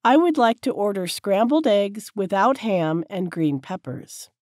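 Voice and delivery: ordinary delivery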